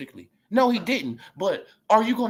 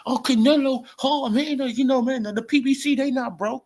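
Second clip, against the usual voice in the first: higher mocking fan voice; mock PBC fan; 8 semitones higher than usual. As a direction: parody the celebrating fans.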